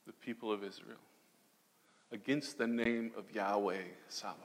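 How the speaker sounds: very soft, low, measured challenge; hushed defiance. Retold or imitated. Young David questioning who dares oppose God's people, hushed defiance. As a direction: sink toward a whisper, with pauses.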